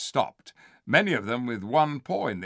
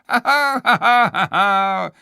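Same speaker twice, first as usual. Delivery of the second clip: high, loud hammed-up cackle; jeering chuckle; 10 semitones higher, 11 dB louder than usual. The ape jeering at the beasts, loud cackle. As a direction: cackle at the cowards. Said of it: "he chuckled".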